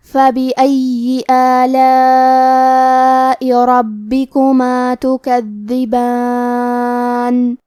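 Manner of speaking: slow, melodic tajweed chant; Quran recitation. Shown as a demonstration. Teacher reciting the verse to students, slow melodic chant. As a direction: draw each vowel out in measured recitation.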